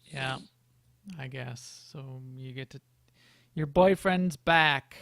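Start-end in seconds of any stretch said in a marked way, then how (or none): 3.5-5.0 teasing singsong. Real, not acted. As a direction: tease her playfully. Teasing about the husband.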